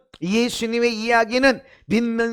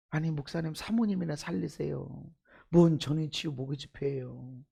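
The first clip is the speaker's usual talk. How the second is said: quiet scoffing; 8 semitones lower, 9 dB quieter than usual. Retold or imitated. Preacher voicing critical churchgoers.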